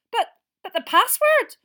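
high, loud, stammering shock; stunned stammer. Acted. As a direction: grasp for an explanation. McGonagall stunned.

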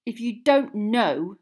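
slow, loud scathing retort. A narrator's reading. Boy refusing to explain himself to his friend, scathing. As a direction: draw it out with loud scorn. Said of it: "said Ron scathingly".

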